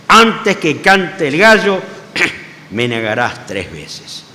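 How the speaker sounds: Jesus' warning echoing in Peter's memory; slow grave quote; solemn recollection. Acted. Peter remembering Jesus' words.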